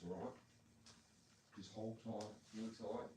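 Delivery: ordinary talk, nothing marked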